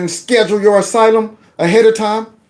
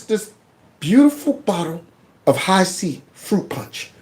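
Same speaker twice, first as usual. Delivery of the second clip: ordinary delivery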